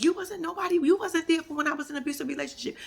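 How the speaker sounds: high mocking imitation of Portia. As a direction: mimic her high and whiny. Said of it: "she was like".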